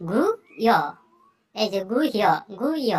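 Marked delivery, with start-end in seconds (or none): 0.1-0.7 গুহ্য split into "gu" and "hyo"; Sanskrit pronunciation. Shown as a demonstration. Teacher shows the Sanskrit sound, গুহ্য as "gu-hyo". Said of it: "গুজ্জটার উচ্চারণ হবে". 1.9-2.9 গুহ্য split into "gu" and "hyo"; Sanskrit pronunciation. Shown as a demonstration. Teacher shows the Sanskrit sound, গুহ্য as "gu-hyo". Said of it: "গুজ্জটার উচ্চারণ হবে".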